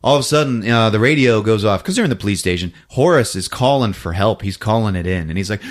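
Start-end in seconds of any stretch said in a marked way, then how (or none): none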